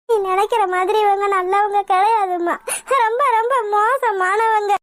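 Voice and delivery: begging through tears; tearful pleading. Acted. Daughter begging her mother to stop the wedding, tearful pleading. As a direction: plead desperately, dragging the last words tearfully.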